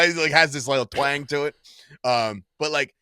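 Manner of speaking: ordinary delivery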